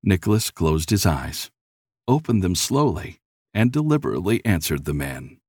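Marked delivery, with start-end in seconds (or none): none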